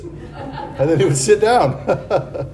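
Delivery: slow, laughing through the words. Real, not acted. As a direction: chuckle it out slowly. Laughing at the punchline.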